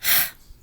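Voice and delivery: an unvoiced huffy sigh; huffy breath. Shown as a demonstration. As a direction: blow out a huff of annoyance. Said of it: "Give me a huffy".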